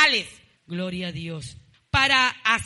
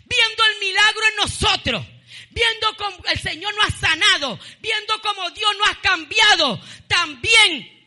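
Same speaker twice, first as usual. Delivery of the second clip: shouting preacher; 7 semitones higher, 5 dB louder than usual. Acted. Preacher tells the church about unbelievers who see miracles, shouted preaching.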